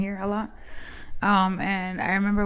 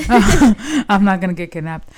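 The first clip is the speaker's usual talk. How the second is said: louder, with a real laugh breaking through; 10 dB louder, slower than usual. Real, not acted. Telling a friend she won't be kidnapped, laughing loudly. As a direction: laugh while brushing off the danger.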